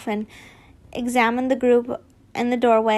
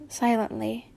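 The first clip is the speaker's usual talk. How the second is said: ordinary delivery, nothing marked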